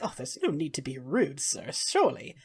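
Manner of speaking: calm the brute with polished ease; smooth rebuke. Acted. Magister smoothly rebukes slaver.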